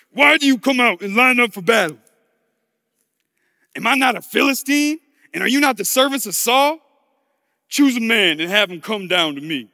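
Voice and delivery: loud battlefield declamation; booming taunt to an enemy army; Goliath's challenge. Acted. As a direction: throw down the challenge, loud and measured.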